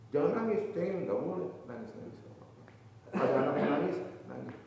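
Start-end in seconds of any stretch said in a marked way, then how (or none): 0.1-1.5 sharp, raised scolding of a crybaby. Acted. Preacher voicing a parent scolding a crying person, sharp and raised. Scolding someone who cries. 1.7-2.6 hushed, much softer, almost whispered; soft permission. Acted. Preacher voicing someone gently allowing a sad person to cry, hushed.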